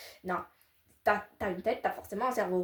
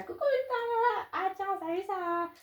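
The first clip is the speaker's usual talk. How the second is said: chirpy high-pitched hello; high greeting; 10 semitones higher, 4 dB quieter than usual. Acted. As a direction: greet Victoria in a bright squeaky put-on voice. High put-on greeting voices.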